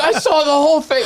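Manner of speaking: high-pitched, over-eager mock witness. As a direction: pipe up high like a keen witness. Plays himself as over-eager witness.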